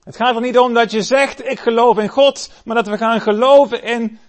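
high, loud fervent preaching; preaching at full force. Acted. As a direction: proclaim it to the whole church. Preacher drives home the point.

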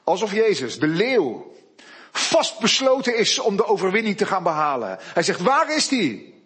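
loud, high, building preaching; preaching at full force. Real, not acted. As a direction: rise to a triumphant climax. Preaching climax.

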